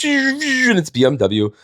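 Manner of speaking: slow, drawn-out high mocking voice; mock snooty driver. Mocking the speeding Illinois driver, slow and high. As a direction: sneer out the brand name slowly. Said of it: "a fib comes by and just goes".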